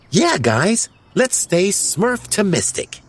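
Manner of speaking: high, perky, encouraging character voice; cheerleader. A narrator's reading. Smurfette tries lifting everyone's spirits, cheerleading. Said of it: "Always the cheerleader".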